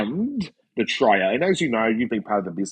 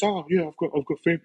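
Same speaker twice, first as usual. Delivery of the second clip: lightly voiced impression of a friend, higher; Talks impression; 6 semitones higher, much faster than usual. Mate answering an invite to meet in Melbourne, light impression. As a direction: imitate your mate's casual reply. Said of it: "That's my talks, by the way".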